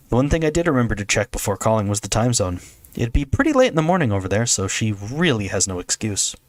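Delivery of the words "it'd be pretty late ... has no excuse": plain reading voice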